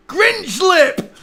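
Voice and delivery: high, loud gleeful cry; excited shout. Real, not acted. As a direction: yell the catchphrase. Repeats excited catchphrase.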